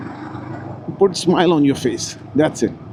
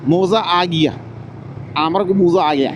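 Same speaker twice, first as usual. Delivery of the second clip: put-on मौज़ा with a rounded o in Bengali accent; playful Bengali accent, मौज़ा and आमर. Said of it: "अब बांगोली भाई, मेरे को माफ़ कर देना".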